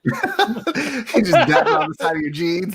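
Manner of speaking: slow joke delivered through real laughter; laughing. Real, not acted. Friend teasing about having no toilet paper, laughing. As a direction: laugh your way through the words.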